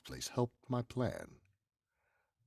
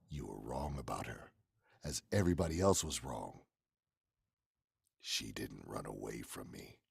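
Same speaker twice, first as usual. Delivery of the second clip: usual speaking voice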